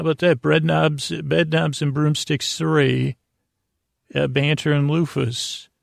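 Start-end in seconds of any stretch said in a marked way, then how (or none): none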